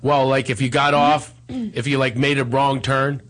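normal talking voice

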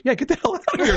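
high, laughing mock exasperation; exasperated dismissal. Cartoon creator sending the comic away, mock-exasperated high dismissal. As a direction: shoo him out in mock annoyance. Seth dismissing him in story.

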